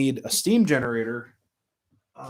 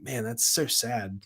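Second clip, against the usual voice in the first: softer and slower, letting the sadness sink in; quiet sad sigh; 2 semitones lower, 7 dB quieter than usual. Retold or imitated. Host recalling the retired engine, soft slow sadness. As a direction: say it like the news just deflated you. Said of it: "I'm like".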